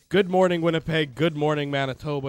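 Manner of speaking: bright, loud show opener; radio host greeting. Acted. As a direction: greet the listeners big.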